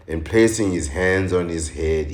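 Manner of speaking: ordinary talk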